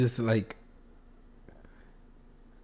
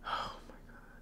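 awed whisper; 21 dB quieter than usual. Real, not acted. Stunned by performance.

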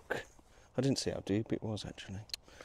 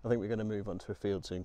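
usual speaking voice

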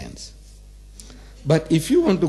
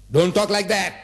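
raised, higher-pitched reprimand; stern rebuke; 6 semitones higher than usual. Retold or imitated. Allah rebuking those who claim a son, stern. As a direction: cut them off sternly. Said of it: "Allah says".

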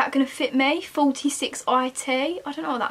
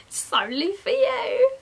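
teasing giggle; 11 semitones higher than usual. Real, not acted. Teasing Ellis.

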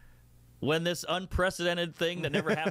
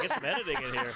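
laughing; 10 semitones higher, faster than usual. Real, not acted. Laughing at long footage.

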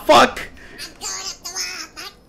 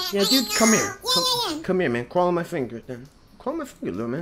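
very soft, low coaxing; hushed coaxing; 9 semitones lower, 12 dB quieter, faster than usual. Pretend. Player luring a cockroach onto a finger, hushed coaxing. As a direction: coax softly, as to a tiny creature.

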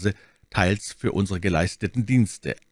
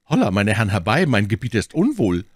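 raised, alarmed call for help; urgent call; 3 semitones higher, 5 dB louder than usual. Acted. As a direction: call the others over urgently. Servant calls friends over for help.

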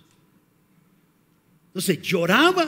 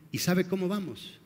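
ordinary talk

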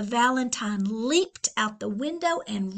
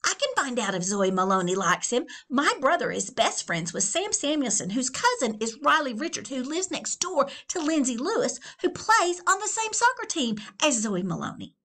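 fast eager patter; rapid gossip; 4 semitones higher, 3 dB louder, faster than usual. Acted. Littlest teen to Leon, fast patter. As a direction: rattle off the chain.

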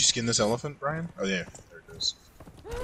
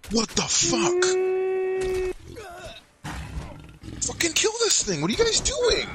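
high frustrated yelling. Real, not acted. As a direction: yell at them to fight. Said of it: "I'm yelling at the thralls".

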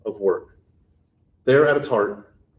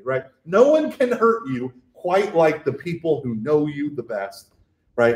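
usual speaking voice

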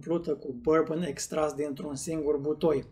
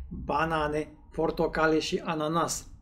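talking over a raised glass; glass held under lips; speaking with glass near mouth; 3 dB quieter than usual. Real, not acted. Seen 1: Tasting glass held near chin and mouth throughout the words.

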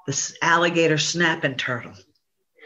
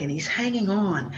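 plain reading voice

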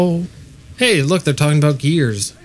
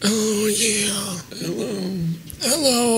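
put-on soft, high old man hello; lightly voiced old man, pitched up; old man bit; 5 semitones higher, 8 dB quieter, much slower than usual. Player joining friend's bit, old man voice. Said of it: "What about Callan's old man?".

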